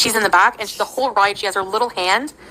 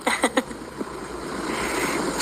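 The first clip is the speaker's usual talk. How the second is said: soft airy laugh; 4 semitones higher, 9 dB quieter than usual. Real, not acted. After super chat.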